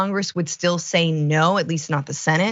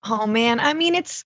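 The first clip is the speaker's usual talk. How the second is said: normal talking voice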